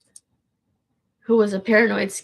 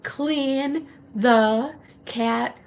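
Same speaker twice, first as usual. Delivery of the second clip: slow sing-song; 4 semitones higher, much slower than usual. Shown as a demonstration. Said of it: "repeating a chore line".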